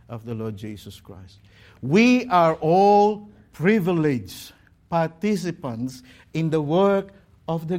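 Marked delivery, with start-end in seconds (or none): 1.8-6.0 slow, weighty emphasis on "privileged"; stress on privileged. Real, not acted. Preacher reminding the congregation none is indispensable, stressing "privileged". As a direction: set "privileged" apart with deliberate, emphatic pacing. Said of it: "I put the emphasis on the privilege".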